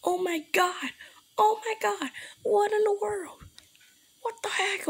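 shocked frightened yelling; panicked shouting. Real, not acted. Sees Siren Head, panics.

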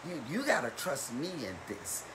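hushed, low counsel, as if from God; the Lord reassuring. Acted. Preacher voicing the Lord to the congregation, quiet reassurance. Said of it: "Or the Lord tell us".

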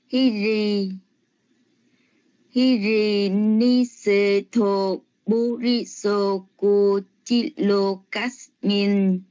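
slow, drawn-out Pali chanting of the verse; Pali recitation. Shown as a demonstration. Student reciting a Dhammapada verse to the teacher, slow Pali chant. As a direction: intone each Pali word slowly and evenly. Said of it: "[name] xin đọc câu pháp cú".

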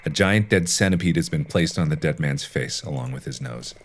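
ordinary delivery

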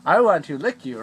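boisterous pretend dog talking; loud playful dog voice. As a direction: talk loudly as a silly dog.

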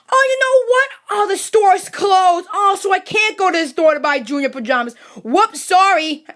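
exaggerated, insincere apology; fake regret. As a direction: feign a sudden handy discovery. Pretends store closed to get out.

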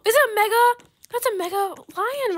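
very high, loud gasp, then is it a mega; sharp excited gasp. Real, not acted. Gamer spotting a mega lion, gasping excitedly. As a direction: gasp in sudden delight.

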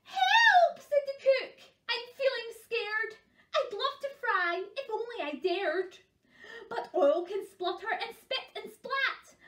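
high, loud cries of a panicky cook; scared cook. Acted. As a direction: wail it like a frightened wimp in a story.

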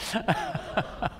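a light, high burst of laughter; high breathy laugh. Real, not acted. Diplomat laughing at the punchline of his own text-message story.

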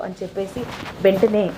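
usual speaking voice

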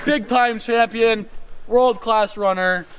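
hyped sports announcer voice; race announcer. Pretend. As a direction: introduce the runners like a commentator.

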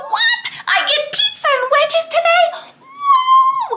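high, loud squealing delight; excited. Pretend. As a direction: shout it like the best news ever. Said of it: "you gotta go".

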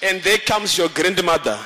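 usual speaking voice

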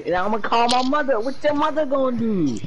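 high jeering voice; mocking taunt. Friend replaying the threat, high jeer. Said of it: "he said".

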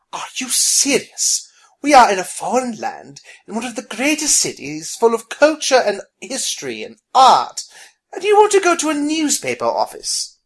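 high, loud, indignant protest; exasperated disbelief. Acted. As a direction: climb into incredulous exasperation. Abigail rolls eyes, glares at him.

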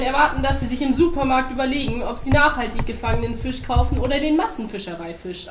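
loud, high-pitched rant; heated argument. A narrator's reading. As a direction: argue with mounting exasperation. Bear rants back in quarrel.

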